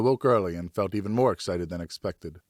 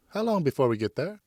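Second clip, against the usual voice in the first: put-on much higher, eager young son; boy's voice; 7 semitones higher than usual. Son asks his father for a moment, in a high boyish voice. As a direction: voice the boy hopeful and polite.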